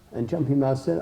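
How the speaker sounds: plain reading voice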